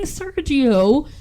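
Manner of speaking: mock cry across the campfire; mock scream. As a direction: imitate screaming out a name, held back. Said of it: "can hear her screaming".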